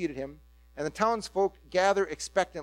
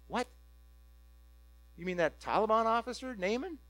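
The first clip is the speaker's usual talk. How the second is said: shocked crowd; 2 semitones higher, 7 dB quieter than usual. Retold or imitated. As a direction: react like you misheard.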